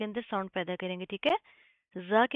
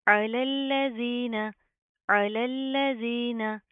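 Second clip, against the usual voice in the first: slow, louder recitation of अलल्लज़ीना; tajweed recitation; 2 semitones higher, 4 dB louder, much slower than usual. Shown as a demonstration. Teacher reciting slowly for students. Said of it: "आइन की आवाज़ को आपने proper वाज़े करना है".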